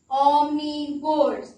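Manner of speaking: omnivores. stretched out slowly; slow key word. Shown as a demonstration. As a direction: draw out omnivores. for the children. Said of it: "How do you spell omnivores?".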